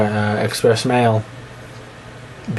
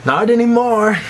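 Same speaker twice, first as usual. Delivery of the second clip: high mock reveal; 11 semitones higher than usual. Joke reveal after the secret wink.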